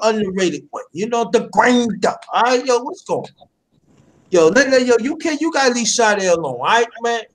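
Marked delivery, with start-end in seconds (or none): none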